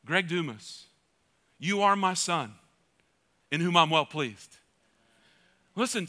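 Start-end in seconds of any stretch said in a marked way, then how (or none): 0.0-4.6 lower, slow, spaced pauses; hint of a solemn divine voice; voice of God. God speaking to the preacher, lower and solemn. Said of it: "God said to me".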